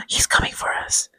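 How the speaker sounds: gasp, then whispered dread; hammed-up horror whisper; mock fear. Mock alarm, gasping.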